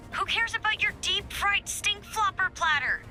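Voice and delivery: high, irritated griping; exasperated outburst. Acted. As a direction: complain in high-pitched disbelief. Annoyed at overheard lunch chatter.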